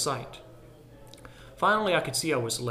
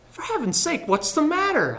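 sharp, much higher and a bit louder; annoyed; 12 semitones higher, 3 dB louder than usual. A narrator's reading. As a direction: snap at him, fed up. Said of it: "I asked, annoyed".

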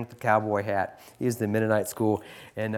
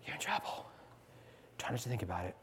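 whisper; 12 dB quieter, faster than usual. Acted. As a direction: lean in and whisper the threat. Teacher warns student privately.

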